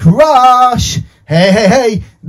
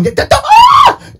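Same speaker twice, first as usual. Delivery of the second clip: startled shriek; 5 dB louder, slower than usual. Pretend. Puppet throws the phone.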